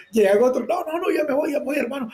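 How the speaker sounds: high pleading, backing off; nervous pleading. Retold or imitated. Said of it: "le digo, yo". (súplica nerviosa para escapar)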